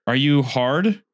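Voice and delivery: normal talking voice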